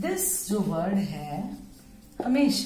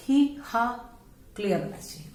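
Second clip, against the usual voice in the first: ordinary delivery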